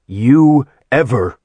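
menacing threat. A narrator's reading. Deimos threatening, cut off.

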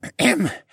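small high throat-clearing; character's little "ahem". A narrator's reading. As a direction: clear your throat before retrying. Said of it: "He cleared his throat and tried again".

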